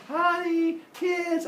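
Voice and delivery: high sing-out to the family; calling out home. Acted. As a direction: hail the household in a high, carrying voice. Father calls family on coming home.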